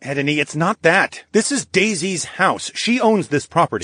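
protesting. Acted. Charles objecting to Edney's plan, mildly protesting.

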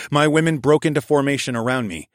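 ordinary delivery, nothing marked

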